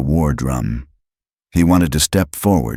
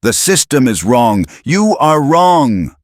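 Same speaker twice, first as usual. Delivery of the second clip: scream; 12 semitones higher, 5 dB louder than usual. A narrator's reading. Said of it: "He wanted to scream at Travis".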